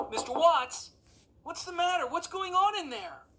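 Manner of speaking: high loud urgent call. Acted. Boy pounding on the cabin door calls out, urgent. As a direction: demand an answer through the door. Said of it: "Henry knocked furiously".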